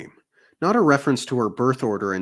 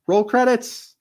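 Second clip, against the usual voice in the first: mock climax; 11 semitones higher, 4 dB louder than usual. Real, not acted. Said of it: "joke ending".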